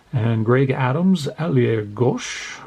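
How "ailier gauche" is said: slow mock French ailier gauche; amateur French accent. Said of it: "My French accent is amazing".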